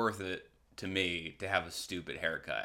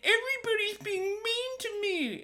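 put-on very high, whiny baby voice; squeaky mock-hurt whine; mock crybaby. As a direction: whimper it like a pouting child. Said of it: "it's like".

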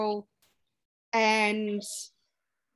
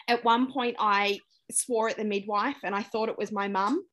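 normal talking voice

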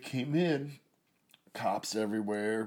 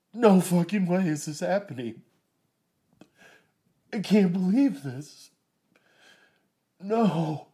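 stunned disbelief; 6 semitones higher, 10 dB louder than usual. Retold or imitated. Disbelief at the scene.

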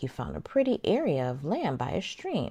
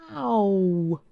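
sharp, louder yelp; yelp of pain; 3 semitones higher, 3 dB louder than usual. A narrator's reading. Wolf yelping after landing in boiling soup. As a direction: cry out as if burned.

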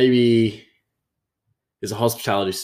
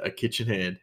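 normal talking voice

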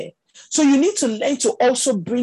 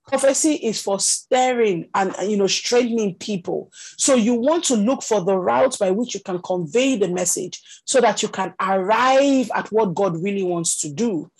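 ordinary talk, nothing marked

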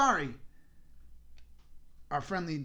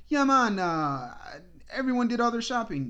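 loud, upbeat driver voice; a hint of the chatty driver; imitating the driver; 5 semitones higher, 5 dB louder than usual.

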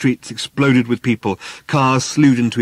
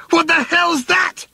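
very high, slow shriek of alarm; slower than usual. Acted. Arthur on his back seeing ships in the sky, terrified shriek. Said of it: "he shrieked".

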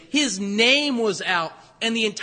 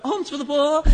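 put-on English accent; 5 semitones higher than usual. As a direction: call out for coins in an English accent. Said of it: "he says it in an English accent".

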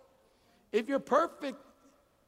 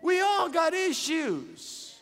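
slow, emphatic preacher cadence, higher and louder; full-force preaching; 6 semitones higher, 4 dB louder, slower than usual. Acted. Pastor exhorting the congregation, at full preaching force. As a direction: proclaim it like a sermon climax.

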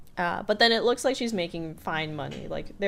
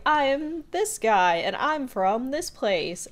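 slow contestant intro; 7 semitones higher, 4 dB louder, slower than usual. Retold or imitated. Game show contestant introductions.

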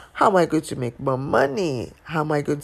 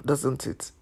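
normal talking voice

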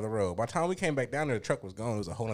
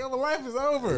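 mock despair; 13 semitones higher than usual. Retold despair over no mice.

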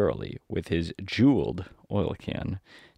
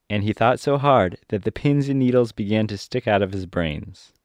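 usual speaking voice

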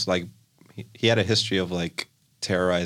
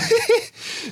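high burst of laughter; 3 dB louder than usual. Real, not acted. Reacting to the guy's address.

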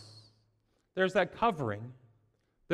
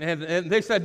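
normal talking voice

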